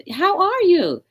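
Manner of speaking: very high-pitched acted greeting; retold greeting. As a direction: send the greeting way up high, as a re-enactment. Acting out own greeting to worker.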